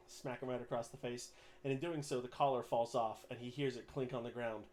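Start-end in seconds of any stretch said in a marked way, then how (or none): none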